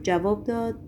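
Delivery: ordinary delivery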